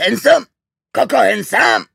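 loud high call across the yard. A narrator's reading. Father calls for Handsome from the porch, loud and high. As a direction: shout his name from the porch.

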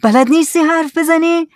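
high, loud impatient demand; shouting. Acted. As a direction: shout to force an answer. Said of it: "زکریا داد زد". (داد زدن سر کسی)

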